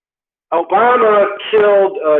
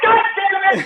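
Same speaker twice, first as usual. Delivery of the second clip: mock outburst; 10 semitones higher than usual. Frustrated at Obama's answer.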